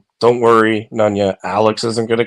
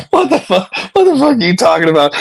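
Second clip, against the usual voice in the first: much higher, louder, fast disbelieving yell; incredulous outburst; 9 semitones higher, 3 dB louder, faster than usual. Retold or imitated. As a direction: blurt it out in total disbelief. Exasperated retort to parent excuse.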